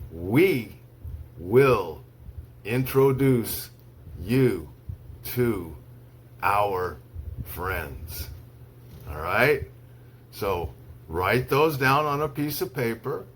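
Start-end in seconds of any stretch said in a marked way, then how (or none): 0.1-8.3 slow, one word at a time with long pauses; slow dictation. Shown as a demonstration. Teacher reads to students for dictation, slow, word by word. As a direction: read it word by word so they can write. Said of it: "I'll say each sentence slowly three times".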